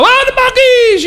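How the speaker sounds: high, bright mock greeter cry; store greeter. Pastor imitating Buc-ee's staff greeting customers, high and bright. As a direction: call it out like a cheerful greeter.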